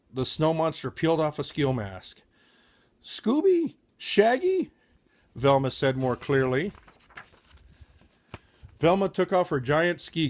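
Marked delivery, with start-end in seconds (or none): 3.0-4.7 surprised call. Acted. Velma unmasking, calling friends.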